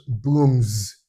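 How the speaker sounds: "brooms" drawn out with a final z; voiced plural ending. Shown as a demonstration. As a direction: stretch "brooms" slowly into a buzzing z. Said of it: "when you add S to it".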